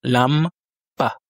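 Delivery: lampa in Russian, stress on the first A; Russian pronunciation. Shown as a demonstration. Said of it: "donc deux syllabes".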